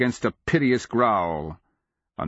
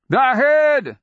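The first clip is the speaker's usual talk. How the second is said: high, loud battle scream; Akamian's scream; 8 dB louder than usual. A narrator's reading. Wizard to his allies fighting the dragon, screaming. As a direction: scream it out at full force. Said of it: "Akamian screamed".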